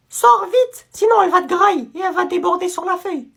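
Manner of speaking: high-pitched, exasperated teacher; angry scolding. Acted. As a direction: scold the pupil in a high, irritated voice. Teacher angrily sends pupil out.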